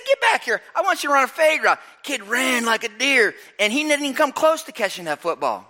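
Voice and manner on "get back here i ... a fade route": high, urgent call; coach calling out. Retold or imitated. Frustrated coach calling his receiver back to run a fade, high urgent call. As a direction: bark the player back, quick. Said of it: "I said".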